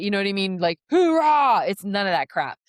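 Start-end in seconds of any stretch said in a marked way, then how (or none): 0.9-1.6 higher, louder hammed-up cheer; a big mock hooah; mock rally cry. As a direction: shout it like a drill-sergeant rally. Mocking a rah-rah push.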